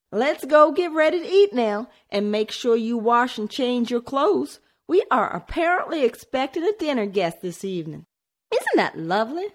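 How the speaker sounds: high, lightly voiced cheerful mother; forced cheerful tone. A narrator's reading. Mother sending children to wash for dinner, forced cheer. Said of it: "she mustered a cheerful tone".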